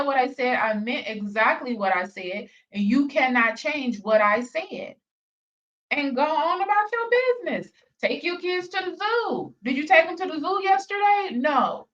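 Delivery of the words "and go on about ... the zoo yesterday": mock mother nagging. Mocking the other woman's talk.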